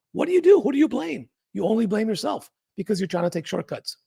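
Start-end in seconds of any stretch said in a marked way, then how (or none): 0.1-1.3 sudden high, louder rhetorical question; exasperated challenge. Real, not acted. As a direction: throw the question at them, incredulous. Rhetorical challenge to lazy traders.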